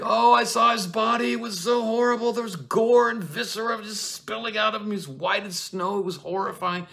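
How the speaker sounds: shrieking high and loud, wailing "he's dead"; hysterical nurse. Acted. Nurse bursting in to Juliet, shrieking in a hammed-up panic. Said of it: "the nurse is like".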